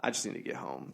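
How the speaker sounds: low, soft weary mumble; drained and understated; exhausted, retold. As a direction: say it as if worn out. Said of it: "I was exhausted".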